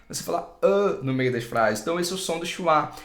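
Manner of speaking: ordinary talk, nothing marked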